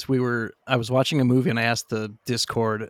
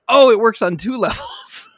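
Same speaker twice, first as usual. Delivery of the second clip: louder, higher, laughing through it; proud of the joke, laughing loud; loud laughing brag; laughing boast; 9 semitones higher, 6 dB louder than usual. Retold or imitated. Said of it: "And I went".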